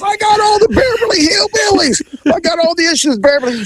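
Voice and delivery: very high mock yelp of pride; outraged boasting. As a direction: imitate a worked-up collector, pitch shooting up. Retold man's outraged boast.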